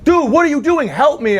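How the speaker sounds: high, exasperated plea; dying victim. Retold or imitated. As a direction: plead with the useless cop. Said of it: "He's like".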